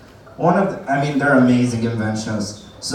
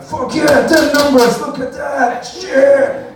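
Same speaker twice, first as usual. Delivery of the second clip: loud, high rowdy whooping; beer-drinking lads; 12 semitones higher, 5 dB louder, much slower than usual. Acted. Boys comparing belts over beer, rowdy shouting. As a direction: holler it like drunk boys showing off. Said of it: "boys going out drinking beer going like".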